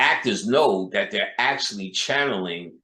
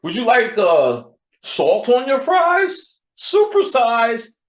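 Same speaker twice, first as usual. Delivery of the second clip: much higher, louder and slow, hammed-up cashier; mock cashier; 13 semitones higher, 7 dB louder, slower than usual. A McDonald's worker while the boss watches, hammed-up politeness. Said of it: "When your boss come around".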